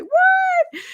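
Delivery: very high, loud innocent squeak; innocent child. Retold or imitated. Child answering dad's question, high loud innocent what. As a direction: play the child who pretends she did nothing.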